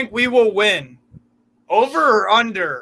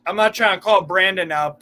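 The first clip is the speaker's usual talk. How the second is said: ordinary talk, nothing marked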